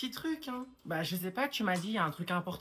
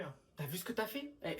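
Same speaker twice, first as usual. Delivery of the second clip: ordinary talk, nothing marked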